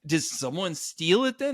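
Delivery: play-acted innocent wondering; feigned innocence. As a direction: ask it as if you know nothing. Retold feigned innocent question.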